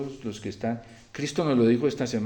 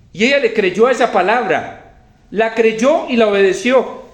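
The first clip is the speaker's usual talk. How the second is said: loud, high, emphatic proclamation; forceful preaching; 7 semitones higher, 9 dB louder than usual. Real, not acted. Preacher proclaiming Elijah's promise to the widow, forceful preaching. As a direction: preach it out loudly to the congregation.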